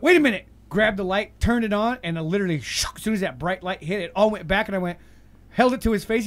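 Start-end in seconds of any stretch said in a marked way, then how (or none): 0.0-0.4 louder, higher wait a minute; sudden loud realization. Retold or imitated. Retelling his own sudden realization, calling out loud. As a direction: snap to it, louder. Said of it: "And then I went".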